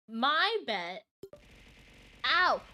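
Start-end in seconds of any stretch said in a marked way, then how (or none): 2.3-2.6 sudden loud high yelp; startled ow; yelp of pain. Real, not acted. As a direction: cry out as if struck. Hit in game, yelps.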